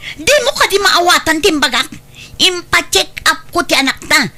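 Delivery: high, loud scolding of the husband; angry scolding. Acted. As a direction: chew him out for ignoring the sick child. Wife scolds husband in quarrel. (nakapungtot nga panangbabalaw)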